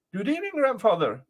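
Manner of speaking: put-on high-pitched boy calling a greeting; cheeky boy's greeting. Boy greets giant man.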